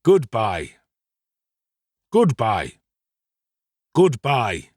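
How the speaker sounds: stoutly, in short separate repeats. A narrator's reading. Old gentleman to the sick boy, stoutly. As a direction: say each one firmly, with a falling end. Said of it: "said Mister Brownlow stoutly".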